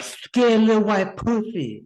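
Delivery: high, slow put-on voice; mock creepy voice. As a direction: squeak it out slowly in a silly put-on voice. Mock voicing friend's reply.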